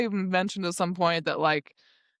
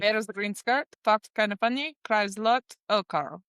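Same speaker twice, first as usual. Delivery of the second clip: put-on Russian accent, a bit higher; 4 semitones higher than usual. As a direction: play the character with a Russian accent. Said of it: "I should be doing this in my Russian accent".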